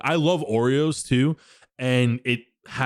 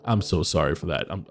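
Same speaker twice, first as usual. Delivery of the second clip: usual speaking voice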